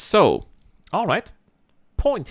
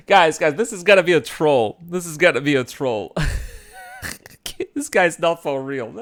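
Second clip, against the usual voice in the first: ordinary delivery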